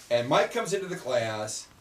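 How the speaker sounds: ordinary talk, nothing marked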